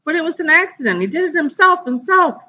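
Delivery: put-on high sobbing girl; high tearful wail. A narrator's reading. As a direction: plead through rising tears. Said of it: "Sheila cried louder".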